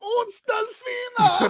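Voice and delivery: very high, drawn-out cry; mock announcer call. Shown as a demonstration. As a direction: stretch it out as a squealed commentator call. Mimics the call, adding uns.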